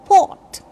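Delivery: "port" with no R before the T; silent R in port. Shown as a demonstration. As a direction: say "port" as "pawt". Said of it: "you know, silent".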